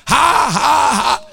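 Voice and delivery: loud, mock ha-ha in spoken syllables; dry forced laugh. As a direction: push out a fake laugh of defiance. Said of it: "just like that".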